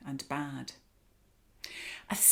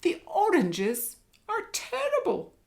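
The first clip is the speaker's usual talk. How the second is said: much higher, loud elephant cry; elephant bellow; 13 semitones higher, 6 dB louder than usual. Acted. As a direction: bellow your disgust as the elephant. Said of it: "bellowed Elephant".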